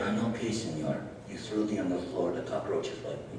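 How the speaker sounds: low Mexican puppet voice; deep talking burrito. Acted.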